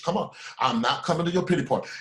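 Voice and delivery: usual speaking voice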